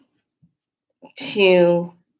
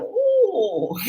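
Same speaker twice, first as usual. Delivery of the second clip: wary ooh; 14 semitones higher than usual. Retold or imitated. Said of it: "they're like".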